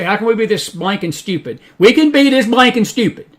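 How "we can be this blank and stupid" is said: high, loud exasperated answer; emphatic retort. Real, not acted. Preacher answering listeners' question, loud and emphatic. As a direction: fire the answer back, raised voice.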